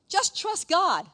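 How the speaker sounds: loud, high, brushing-off "Just trust God."; harsh dismissive. Shown as a demonstration. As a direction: snap it as a brush-off. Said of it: "the way I'm saying it ... feel very small".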